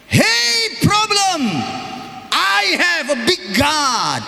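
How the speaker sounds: slow, high, triumphant shout; bold shout. Shown as a demonstration. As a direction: declare victory over the problem. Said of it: "You must say". (teriakan berani penuh iman)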